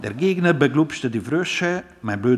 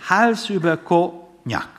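lifted, slow sighing line, a little louder; mock sigh; 6 semitones higher, 5 dB louder, slower than usual. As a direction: sigh it out dreamily to mother. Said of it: "seufzte er".

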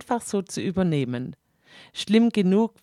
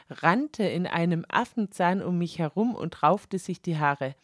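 ordinary delivery

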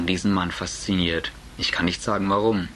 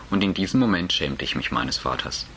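ordinary delivery, nothing marked

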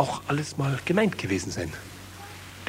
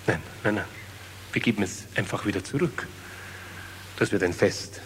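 hushed; 5 semitones lower, 9 dB quieter than usual. Acted. Hushed continuation.

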